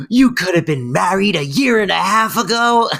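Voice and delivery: gasp, then high incredulous question; hammed-up disbelief, pitched high; mock outrage. Sylvia learning of Fran's near marriage, mock-aghast.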